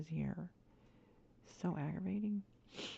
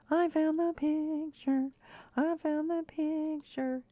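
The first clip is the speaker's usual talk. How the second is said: much higher, slow, gleeful repeat; real delighted repetition; happy singsong; 11 semitones higher, slower than usual. Real, not acted. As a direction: sing it out, savoring the win. Repeats his joy.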